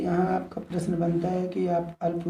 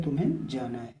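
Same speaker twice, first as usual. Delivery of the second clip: ordinary talk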